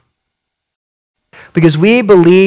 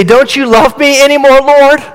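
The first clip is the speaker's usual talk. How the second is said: high, pleading, self-pitying prayer; whiny prayer; 9 semitones higher, 4 dB louder than usual. Shown as a demonstration. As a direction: whine at God like a spoiled child. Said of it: "I pray like this".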